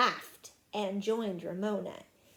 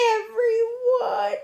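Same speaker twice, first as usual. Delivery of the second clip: high, loud, drawn-out wail; tearful; 12 semitones higher, 9 dB louder, slower than usual. Acted. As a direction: whine it to the teacher. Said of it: "said Susan tearfully".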